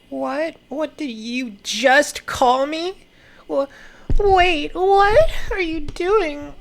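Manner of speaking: high, slow, indignant What did you just call me; shocked outburst. Acted. Earth Chan offended by insult, high shocked squeal. Voicing shocked character in comic.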